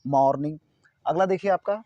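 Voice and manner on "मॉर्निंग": "मॉर्निंग" with an open 'maw'; correct pronunciation. Shown as a demonstration. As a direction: say "मॉर्निंग" as MAWR-ning, lips apart. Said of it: "इसको बोलेंगे मॉ". ("मॉर्निंग" में खुला 'मॉ', 'मो' नहीं)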